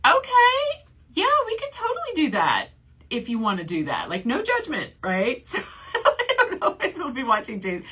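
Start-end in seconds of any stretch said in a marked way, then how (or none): 0.1-4.1 high mock-eager agreement. Telling her fiance yes in a high sing-song voice. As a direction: voice jumps way up, falsetto-bright yes. Acted reply to partner's suggestion.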